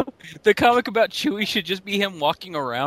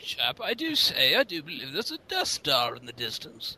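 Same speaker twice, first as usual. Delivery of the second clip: mock Elizabethan accent; 6 dB quieter than usual. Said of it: "Elizabethan accent".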